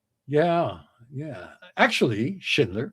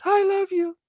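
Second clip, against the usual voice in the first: "I love you" squeaked far higher in a hammed-up voice; lovestruck husband. Husband answers the wife's "higher" in a squeaky high voice. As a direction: swoon it out in a silly high voice. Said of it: "And he goes".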